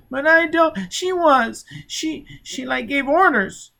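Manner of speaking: put-on high, halting child's voice; high frightened child faltering; scared stammering child. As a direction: stumble over the words in fear.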